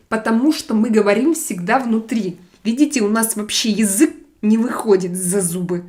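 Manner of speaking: fast Russian, loud and tongue kept inside the mouth; Russian speech demo. Shown as a demonstration. Streamer demonstrating to chat how Russian is spoken, fast and loud. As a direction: rattle off the Russian fast to show how it sounds. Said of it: "we talk like this".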